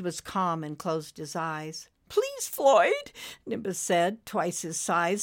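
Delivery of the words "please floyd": high, begging little voice; pleading. Acted. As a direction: plead with him. Nimbus begs.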